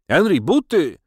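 high, loud call; parting shout. A narrator's reading. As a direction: shout after someone who is leaving. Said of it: "krzyknąłem na pożegnanie".

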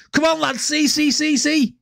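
imitating a Scouser begging you to look; Scouse impression. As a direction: urge them on in a Scouse accent. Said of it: "Like that".

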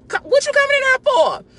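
high-pitched incredulous scolding; exasperated, high-pitched rebuke; indignant challenge. Real, not acted. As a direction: call them out, voice jumping way up. Scolding the gossiper just imitated.

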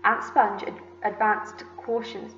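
plain reading voice

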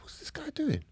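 high, very soft gasped whisper; hushed bewilderment. Retold or imitated. Man alone in a cubicle wondering about neighbour, whispered bewilderment. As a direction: whisper it to yourself, baffled.

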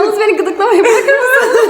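laughing while being tickled; high, giggling, squirming plea. Real, not acted. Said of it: "Gıdıklanıyor musun?". (gıdıklanırken gülerek)

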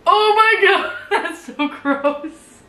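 high laughing squeal of disgust. Real, not acted. Disgusted reaction to dry wine.

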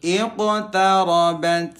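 chanted tajwid with bounced q; Quran recitation. Shown as a demonstration. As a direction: recite slowly, every q audible. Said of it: "qafnya harus kedengeran". (bacaan tajwid dengan qalqalah jelas)

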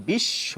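বিশ্ব said as bishsho, doubled sh; বিশ্ব: bish-sho. Shown as a demonstration. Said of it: "আমরা বলি".

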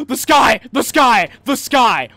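gasping, yelling it out in delight; excited shouting. Real, not acted. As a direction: shout it out, thrilled. Excited shout on seeing sky.

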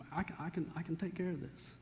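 soft, calm reassuring voice; quiet reassurance. Retold or imitated. Jesus quietly reassuring his mother about the wine. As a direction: gently tell them it's handled.

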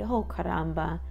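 ordinary delivery, nothing marked

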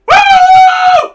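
very high, very loud mock roar; roaring cheer. Host of Isengard roaring, a hammed-up high woo. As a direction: roar like a whole army. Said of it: "the host of Isengard roared".

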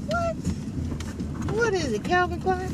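usual speaking voice

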